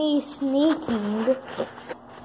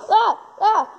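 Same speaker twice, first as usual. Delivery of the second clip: startled yells; 7 semitones higher than usual. Real, not acted. Caught by traps in game.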